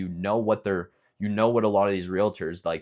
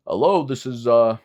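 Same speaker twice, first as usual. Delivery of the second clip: higher, louder phone greeting, lightly acted; mock realtor picking up a call, a bit raised; realtor answering the phone; 5 semitones higher, 10 dB louder than usual. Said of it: "they're gonna be like".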